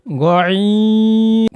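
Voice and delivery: গয়েন held very long from the back of the throat; long ghayn demo. Shown as a demonstration. Teacher models গয়েন with a long hold. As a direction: stretch গয়েন to three alif lengths. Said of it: "কীভাবে উচ্চারণ করছি".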